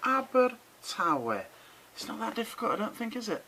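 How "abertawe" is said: "Abertawe" drawn out very slowly, every syllable clear; slow Welsh demo. Shown as a demonstration. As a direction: stretch out "Abertawe" for the learners. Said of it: "This one is pronounced".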